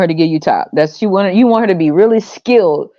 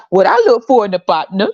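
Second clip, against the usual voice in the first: normal talking voice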